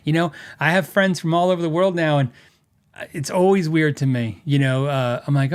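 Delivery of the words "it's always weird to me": ordinary talk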